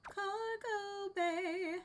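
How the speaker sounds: slow, stretched mock sigh; slow deadpan drag; drawn-out sarcastic repeat. Real, not acted. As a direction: drag the words out wearily. Drawn-out repeat of a place name.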